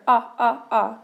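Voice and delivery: "Ah, ah, ah." as an isolated short O sound, repeated three times. Shown as a demonstration. Teacher to class drilling the short O sound, modelled clearly. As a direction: sound out "Ah, ah, ah." as the short O, one beat each. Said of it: "this is a short O".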